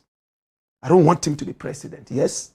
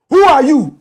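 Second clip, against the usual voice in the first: higher, louder challenge; sharp loud question; 6 semitones higher, 7 dB louder than usual. Real, not acted. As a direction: fire the question at them. Rhetorical question and answer.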